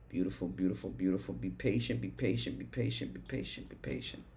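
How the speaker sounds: fading to whisper. Real, not acted. Calms himself, fading to whisper.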